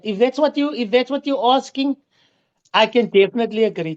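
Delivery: loud, formal well-wishing. Real, not acted. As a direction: address him loudly like a speech. Loud formal new year wishes.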